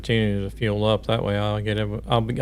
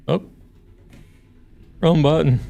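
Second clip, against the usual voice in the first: startled; 6 semitones higher, 7 dB louder than usual. Real, not acted. Hits the wrong button.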